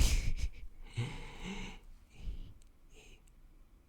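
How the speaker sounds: breathy, voiceless giggle; whispered laugh. Real, not acted. Amused that the card resets the duel, whispered laughing. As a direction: laugh without voice, keeping to a whisper.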